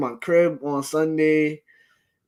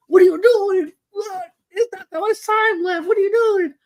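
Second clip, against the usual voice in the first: high, exasperated retold outcry; imitated panic, voice shooting way up; mock yelling; 4 dB louder than usual. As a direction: re-enact fans yelling at the screen. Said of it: "yelling at him".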